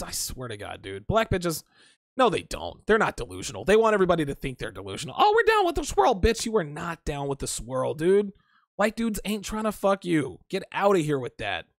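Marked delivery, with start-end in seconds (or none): 5.2-6.1 mocking imitation. Mocking women in chat with a high falsetto. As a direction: sneer it in a much higher mock voice.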